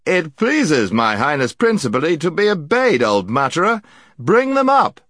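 high imperious call rising to a shout. A narrator's reading. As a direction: bark the order up the stairs. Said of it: "it called".

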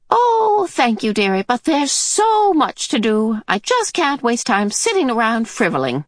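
put-on high, bustling old lady; breezy refusal, high and busy; old woman voice. Mrs Watson declining Mrs Murray's offer, high old lady.

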